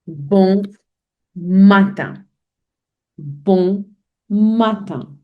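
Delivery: "Bon" then a pause, then "matin"; word split in two. Shown as a demonstration. Teacher splitting Bon matin for learners. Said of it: "we also say".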